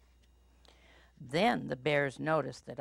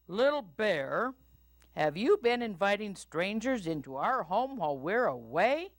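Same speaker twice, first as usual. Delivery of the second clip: higher, sing-song scolding papa; Papa Bear voice; 6 semitones higher than usual. A narrator's reading. Papa Bear questions Little Bear about strangers, scolding. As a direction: question him like a stern father. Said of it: "asked Papa Bear".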